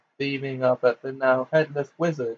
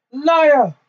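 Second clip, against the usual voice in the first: very high, loud shrieked accusation; 14 semitones higher, 9 dB louder than usual. A narrator's reading. Bellatrix refuses to believe Harry, shrieking. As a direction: shriek it out in denial. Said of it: "she shrieked".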